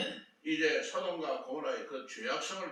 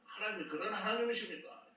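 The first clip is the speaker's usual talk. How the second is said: acted protest, pitched higher; argumentative challenge; 6 semitones higher than usual. Abraham disputing with God over the righteous, argumentative. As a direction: argue it out as a rhetorical challenge. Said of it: "따지는데".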